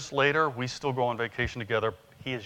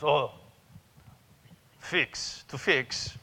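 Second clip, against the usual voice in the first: put-on Yasu's Japanese accent; much slower than usual. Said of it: "So I'm gonna do Yasu's voice".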